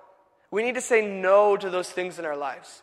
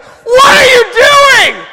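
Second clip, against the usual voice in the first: drawn-out, high screaming outburst; furious yell; 17 dB louder, much slower than usual. Retold or imitated. Moses shouting at the idol-worshiping Israelites, furious high yell. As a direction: roar it in outrage, stretching it out. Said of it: "he was so mad".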